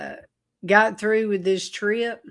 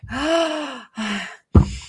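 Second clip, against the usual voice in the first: higher, long eager inhale; rapturous gasp; 6 semitones higher than usual. Retold or imitated. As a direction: gasp hungrily at the scent. Trying to smell the presence.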